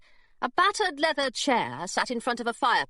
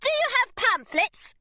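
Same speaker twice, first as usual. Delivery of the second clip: eager gushing. Acted. Girl gushing to distract secretary.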